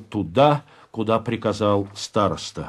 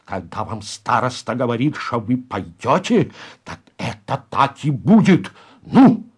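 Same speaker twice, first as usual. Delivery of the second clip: hissed insistence. Acted. Староста forces Залетаев to obey, menace building. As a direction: start contained, explode on the final word. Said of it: "прошипел Пиня с удивительной".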